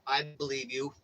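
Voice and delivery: hammed-up nervous "I would believe"; nervous stammer. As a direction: play it as frightened agreement, tripping over words. Stammering reply to hitman threat.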